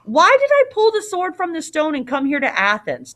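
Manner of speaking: high-pitched indignant outburst; storming. A narrator's reading. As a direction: protest in acted frustration. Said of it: "he stormed".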